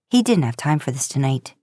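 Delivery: ordinary delivery, nothing marked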